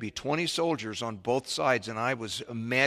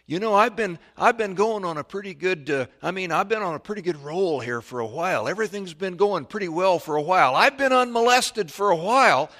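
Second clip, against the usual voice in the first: acted plea rising to a high shout; building agitation; 6 semitones higher, 7 dB louder than usual. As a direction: start raised, climb steadily louder and higher. Acts out complacent believer, building up.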